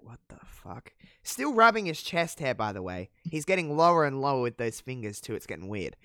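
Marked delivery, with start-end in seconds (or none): 0.0-0.9 imitated whispered disbelief; whispered stunned aside. Voices Trish's stunned aside.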